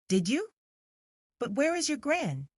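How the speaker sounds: high, gentle questioning; gentle coaxing. A narrator's reading. Coaxing the child.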